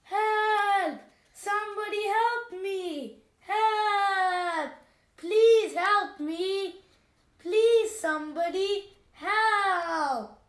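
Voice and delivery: high, loud desperate cries; calling for help. Acted. Trapped lion begs the forest for help, loud and desperate. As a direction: yell for rescue, pleading.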